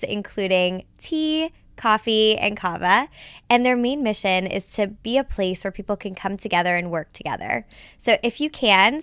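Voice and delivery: loud upbeat sponsor read. Acted. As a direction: pitch the sponsor with energy.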